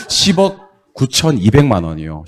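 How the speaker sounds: 십억 구천이백만 said slowly and clearly; slow it down, 십억 first; careful final demo; slow model reading. Shown as a demonstration. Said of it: "오케이".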